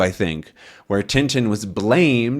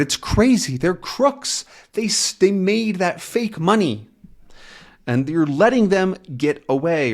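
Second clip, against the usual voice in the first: indignant protest; 7 semitones higher than usual. Acted. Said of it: "Tintin says".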